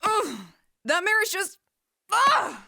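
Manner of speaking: high loud furious groans; frustrated groans. Acted. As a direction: vent the rage alone. Silver alone, fuming after fight.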